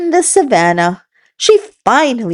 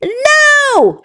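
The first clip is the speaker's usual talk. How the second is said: a monkey screaming at the frog to leave; screamed no. Acted. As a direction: scream it at him. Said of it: "she screamed".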